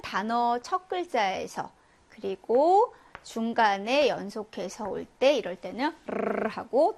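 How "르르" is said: 르르 as one long rolled r; rolled multiple trill. Shown as a demonstration. Teacher shows Spanish erre at word start, 르르 as a vibrating trill. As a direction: trill the tongue tip rapidly through 르르. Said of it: "떨어지는 복합 진동음이 된다".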